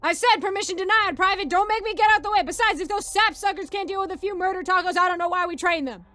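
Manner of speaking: loud, high barked argument; military yelling. Acted. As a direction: shout it like a boot-camp dressing-down. Sergeant berates questioning private.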